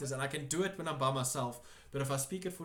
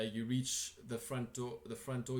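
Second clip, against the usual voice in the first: ordinary talk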